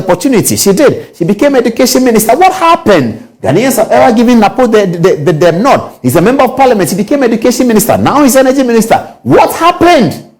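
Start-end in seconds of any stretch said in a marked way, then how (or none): 2.4-3.4 drawn-out, pointed rhetorical question; rhetorical what happened. Real, not acted. Commentator challenging viewers over her record, slow and pointed. 9.2-10.3 drawn-out, pointed rhetorical question; rhetorical what happened. Real, not acted. Commentator challenging viewers over her record, slow and pointed.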